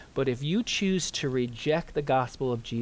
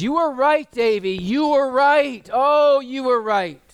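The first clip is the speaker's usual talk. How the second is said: anguished cry, much higher and louder; 13 semitones higher, 10 dB louder, slower than usual. Retold or imitated. Poor man at the funeral crying out to Davy. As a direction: cry it out in grief, voice breaking high. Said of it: "he cries out and says".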